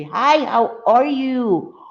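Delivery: high, slow, drawn-out hello; sing-song greeting. Acted. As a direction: greet like a cheery hostess. Greets viewer in chat.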